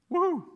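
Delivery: short high, loud "woo"; excited high-pitched cheer; party whoop. Pretend. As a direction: whoop like you're at a wild party. Cheering the mad parties.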